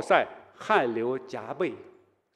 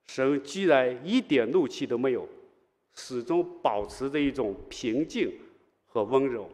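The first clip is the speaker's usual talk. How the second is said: usual speaking voice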